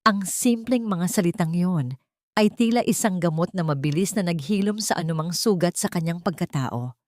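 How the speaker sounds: usual speaking voice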